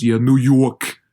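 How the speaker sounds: mock New York accent. Podcaster mocking movie New York accents, in a broad New York accent. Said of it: "The stereotypical New York accent".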